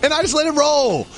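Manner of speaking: a host hitting back at his co-host's correction; indignant comeback. Real, not acted. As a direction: turn the tables, all fired up.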